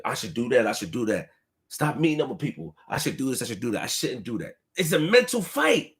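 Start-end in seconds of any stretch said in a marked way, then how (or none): 0.0-4.6 low, quiet voice of his own arguing thoughts; inner voice. Retold or imitated. Talking himself out of trouble, low quiet inner voice. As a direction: mutter it to yourself, low and soft. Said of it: "So sometimes I fight myself mentally".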